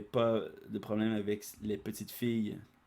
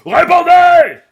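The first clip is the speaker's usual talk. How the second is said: very high, much louder bellow; furious roar; 26 dB louder, slower than usual. Acted. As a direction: roar for an answer. Gyumao demands answer.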